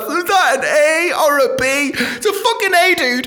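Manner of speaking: a dim driver squinting at one letter; baffled driver. Retold or imitated. Driver on bypass shouting about the Aldi sign, high mock confusion.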